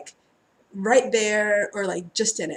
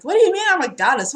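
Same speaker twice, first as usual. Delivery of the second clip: higher, louder, fast incredulous blurt; disbelieving; 7 semitones higher, 6 dB louder, faster than usual. Retold or imitated. Reader recalls her guides calling her a goddess, fast disbelief.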